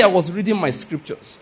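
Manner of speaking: ordinary talk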